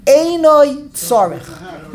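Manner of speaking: slow solemn "Einoi tzarech"; slow weighty reading. Shown as a demonstration. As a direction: read "Einoi tzarech" with rabbinic gravity. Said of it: "You read that like a Rishon".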